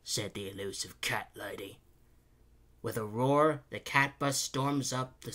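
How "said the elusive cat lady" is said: lower, softer aside; muttering to himself. A narrator's reading. Engineer to himself about the cat lady, muttering. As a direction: mutter it under your breath. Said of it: "he mutters to himself".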